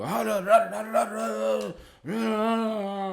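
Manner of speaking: high, loud mock babble of a ranting uncle; mocking uncle's ranting. Mocking his uncle ranting at him, high and loud. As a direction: parody the uncle yelling nonsense. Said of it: "start screaming and talking loud".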